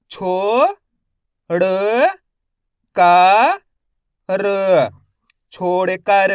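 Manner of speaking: slow spelling drill, each syllable held with a rising end; stretched syllables for beginning readers; letter-by-letter sounding out. Shown as a demonstration. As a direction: sound out each letter, then blend the word. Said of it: "एक एक अक्षर का उच्चारण करके".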